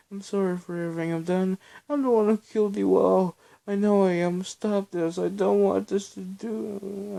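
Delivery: high, loud frantic shouting; losing all control, pleading and raging; screaming hysterically through guilt and panic. A narrator's reading. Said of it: "Belle screamed hysterically".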